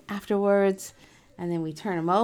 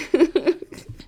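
high laugh; 8 semitones higher, 6 dB louder than usual. Real, not acted. Laugh after okay.

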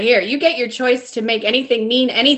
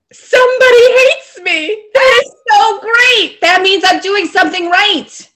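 high, loud, drawn-out delight; gleeful mock cheer; 9 semitones higher, 6 dB louder than usual. Coach acting out her delight at having a hater, gleeful. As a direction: crow with over-the-top joy, stretching the words.

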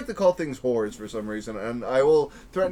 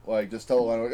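ordinary talk

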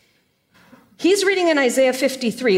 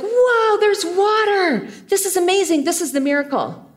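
put-on childish over-the-top awe; mock kid amazement; 6 semitones higher than usual. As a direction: play the awestruck child, big and bright. Childhood self amazed at water.

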